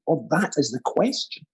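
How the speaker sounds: "that" punched, rising questioningly at the end; stress on THAT. Shown as a demonstration. Actor offering a last Hamlet reading, stress on "that". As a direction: hit "that" and lift the ending. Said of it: "Open to interpretation there".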